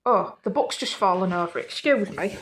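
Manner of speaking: ordinary delivery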